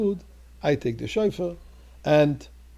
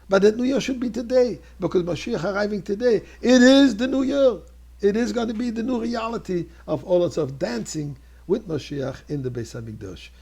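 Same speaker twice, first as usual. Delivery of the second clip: higher and louder, jubilant proclamation; rousing preaching; 8 semitones higher, 8 dB louder than usual. Acted. Rabbi blessing the community for the New Year, jubilant. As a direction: lift the congregation to joy.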